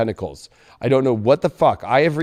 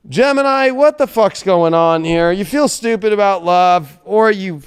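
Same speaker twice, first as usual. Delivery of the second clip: calling out; 5 semitones higher, 5 dB louder than usual. Real, not acted. Calling out to Gemini.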